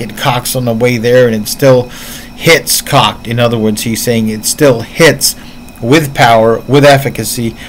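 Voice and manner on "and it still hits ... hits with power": usual speaking voice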